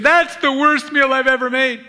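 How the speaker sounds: high, loud, wailing self-pity; wife wailing about her dinner, high and loud; wife's lament. Retold or imitated. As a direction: wail it like the wife. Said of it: "when she said".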